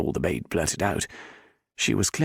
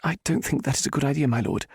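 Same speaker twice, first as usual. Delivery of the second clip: higher, quick woman's voice; hiding fear; 6 semitones higher, faster than usual. A narrator's reading. Heroine nervously refusing the Viscount. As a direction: cover your fear, hurry it. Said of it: "a voice she hoped didn't betray her stark fear".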